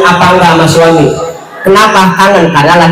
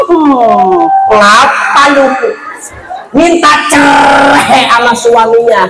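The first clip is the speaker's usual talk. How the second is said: preaching at full force; 9 semitones higher than usual. Acted. Preacher scolding modern wives, preaching hard. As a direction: thunder it at the congregation.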